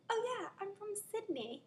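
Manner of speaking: high, badly faked Australian accent; hammed-up bad Aussie accent; awful Australian accent. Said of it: "awful Australian accent".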